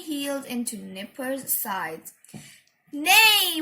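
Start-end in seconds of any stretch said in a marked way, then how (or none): 2.9-3.6 loud high whinny; pony whine. A narrator's reading. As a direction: neigh like a pony. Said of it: "whined Nipper".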